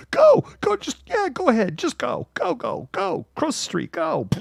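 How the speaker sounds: very high, frantic waving-on; mock crossing guard. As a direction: shoo everyone across in a high squeaky voice. Mocking coach as crossing guard.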